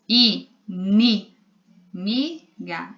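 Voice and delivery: i, ni, mi, ga as separate syllables with pauses; teacher's spaced-out i, ni, mi, ga. Shown as a demonstration. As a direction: sound out i, ni, mi, ga one at a time. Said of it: "Olha o, o som do N".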